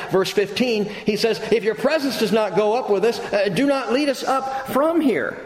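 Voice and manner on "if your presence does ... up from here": Moses voiced high and eager, a touch pleading; excited Moses. Moses urging God not to leave, excited and high. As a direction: plead as if you can't hold back. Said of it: "He's just so excited".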